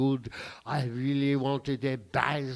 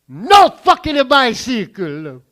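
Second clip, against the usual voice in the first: loud, higher, slow furious yell; loud high furious yell at the empty tree; furious shout; 7 semitones higher, 20 dB louder, slower than usual. Acted. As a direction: shout it out, outraged, dragging each word. Angry punchline repeated.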